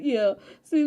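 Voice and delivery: normal talking voice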